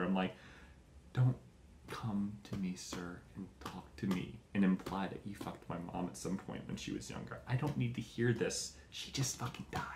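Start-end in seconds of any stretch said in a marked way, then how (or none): 1.1-9.8 mock whisper, bitter and hissed under the breath; hushed retort. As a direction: say it under your breath to a stranger at a funeral. Angry retort to mother's old lover.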